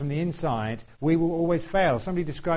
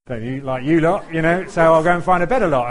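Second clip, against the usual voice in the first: louder, half-joking grumble; playful louder grumble about churchgoers; mock complaint; 3 semitones higher, 9 dB louder than usual. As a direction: tease them with a loud mock gripe. Mocking church-hoppers.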